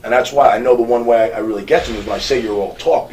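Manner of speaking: ordinary talk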